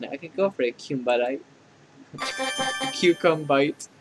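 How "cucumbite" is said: laughing. Acted. Drawn-out pun with a laugh.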